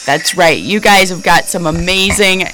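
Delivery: ordinary talk, nothing marked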